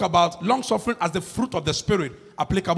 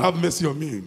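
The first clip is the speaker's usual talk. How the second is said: ordinary talk, nothing marked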